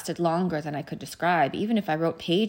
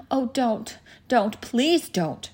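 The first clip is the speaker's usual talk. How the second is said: higher-pitched, urgent begging; desperate plea, pitched up, drawn out at the end; pleading cry; 6 semitones higher, 4 dB louder, slower than usual. A narrator's reading. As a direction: cry out to stop her. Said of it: "shouted Edmund".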